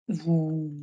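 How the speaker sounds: ordinary talk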